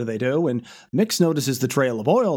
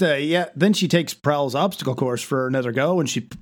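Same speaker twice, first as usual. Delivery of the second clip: ordinary delivery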